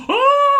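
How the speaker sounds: loud high yelp; mock scream back. Masked tenant screaming back at the landlady, loud and high. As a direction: scream straight back at her. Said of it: "And he goes".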